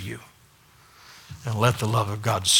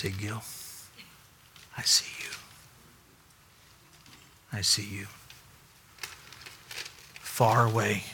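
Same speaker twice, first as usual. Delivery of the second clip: soft, hushed, intimate reassurance; tender whisper; 5 dB quieter than usual. Acted. God speaking to a lost man, tender whisper. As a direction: whisper it like comfort to one person.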